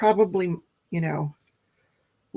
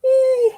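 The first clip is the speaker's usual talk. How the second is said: high stretched yawn sound; big yawn; 8 dB louder than usual. Real, not acted. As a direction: let out a loud yawn. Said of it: "I'm yawning".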